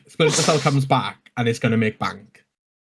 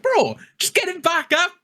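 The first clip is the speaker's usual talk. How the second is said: high-pitched imitation of pleading; squeaky, put-on exasperated voice; mock protest. Retelling a teammate row in the game, mock high-pitched protest.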